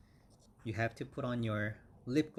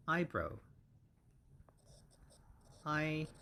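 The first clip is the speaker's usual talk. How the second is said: eyebrow with EYE stressed and said higher; stress on the first syllable; 5 semitones higher than usual. Shown as a demonstration. Teacher models eyebrow, stress on the first syllable. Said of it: "stress is always on the first syllable".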